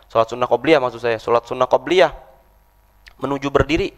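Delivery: ordinary delivery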